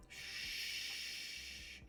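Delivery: soft whispered shush; hushing. Real, not acted. Quieting her yelling cat with a whispered shush. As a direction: hush the cat gently.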